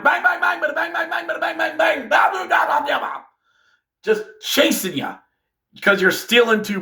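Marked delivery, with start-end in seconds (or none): 0.0-3.2 high chanted bang-bang noises; mock gunfire. Pursuers firing at the bus, high mock gunfire chant. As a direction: rattle off pretend gunshots, much higher. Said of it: "people behind him going".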